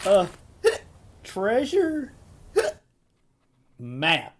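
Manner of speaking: tipsy, halting between hiccups; hiccuping drunk reveal. Acted. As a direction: drag it out word by word, drunkenly. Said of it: "You're crocked, aren't you?".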